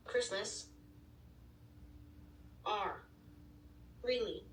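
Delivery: usual speaking voice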